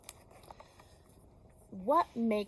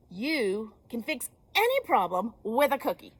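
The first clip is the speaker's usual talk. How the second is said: louder Beaver voice; 3 semitones higher, 7 dB louder than usual. Acted. Beaver's confident advice.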